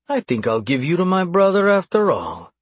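high-pitched, musing threat. A narrator's reading. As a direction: think aloud as you threaten.

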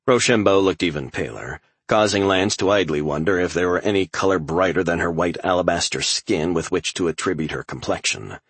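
plain reading voice

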